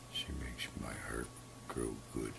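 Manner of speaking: soft unvoiced whisper, tender. Real, not acted. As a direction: breathe the words out softly. Grandfather softly speaking of granddaughter.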